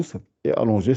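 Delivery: normal talking voice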